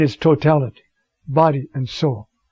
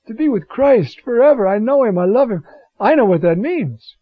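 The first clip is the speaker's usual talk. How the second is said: louder, higher, impassioned declaration; fervent longing; 6 semitones higher, 5 dB louder than usual. Acted. Preacher voicing Paul longing to be with Christ, fervent. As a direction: declare it with rising fervor.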